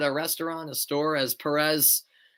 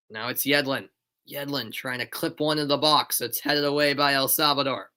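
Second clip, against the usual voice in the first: brisk, lifted match call; play-by-play. Acted. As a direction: call the free kick live.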